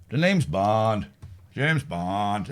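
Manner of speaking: a hint of Pierce Brosnan's Bond. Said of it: "I can do Brosnan".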